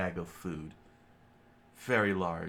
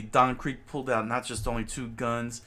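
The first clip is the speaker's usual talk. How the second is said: ordinary delivery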